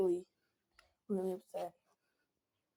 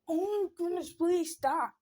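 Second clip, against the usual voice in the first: high, fed-up retold begging; pitched-up exasperation; exasperated plea; 8 semitones higher than usual. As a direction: plead high, at the end of your patience. Retold exasperated reaction to blown kiss.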